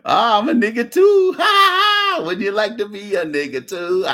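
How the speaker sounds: put-on high Punjabi accent, cracking into a shrieking laugh; mock Punjabi voice laughing through it; teasing Punjabi accent over laughter. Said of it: "they be telling you".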